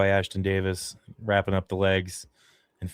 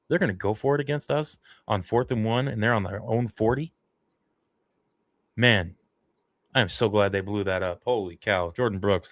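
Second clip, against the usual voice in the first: usual speaking voice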